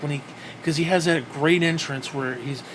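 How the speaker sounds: usual speaking voice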